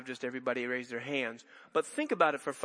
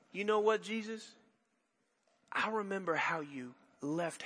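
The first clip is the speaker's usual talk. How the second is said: hushed prayer; 3 semitones lower, 6 dB quieter than usual. Pretend. Preacher voices a shopper's quiet prayer in the store, hushed and prayerful. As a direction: pray it under your breath, mid-shopping.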